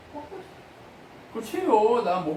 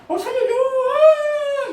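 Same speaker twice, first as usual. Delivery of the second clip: much higher, loud hammed-up yell; mock cry for help; 10 dB louder, much slower than usual. Pastor mocking a Sunday-only believer, hammed-up loud cry. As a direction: parody a panicked Sunday-only plea. Mocks once-a-week churchgoer crying out.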